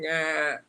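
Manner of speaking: drawn-out imitated bleat; goat bleat. Goat making its only complaint, a bleating "nah". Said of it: "That's your only complaint".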